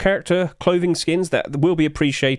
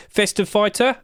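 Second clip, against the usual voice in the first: ordinary talk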